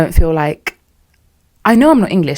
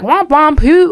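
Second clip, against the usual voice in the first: mock dismissive; 10 semitones higher, 4 dB louder, slower than usual. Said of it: "it sounds like".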